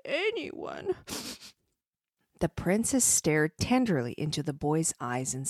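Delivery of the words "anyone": miserable whisper. Acted. Boy tells the princess his burden is too heavy, miserable whisper. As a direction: whisper it as a miserable small child. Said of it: "The child answered miserably".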